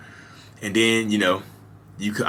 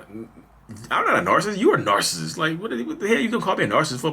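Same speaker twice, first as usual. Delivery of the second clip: mock-indignant, pitched much higher; indignant denial; 9 semitones higher than usual. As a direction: throw the accusation back, higher and faster at the end. He denies it in the quarrel.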